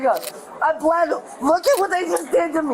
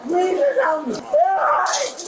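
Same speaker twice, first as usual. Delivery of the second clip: crying, begging for help; desperate crying plea; slower than usual. Real, not acted. Hurt, cuffed, begging for help.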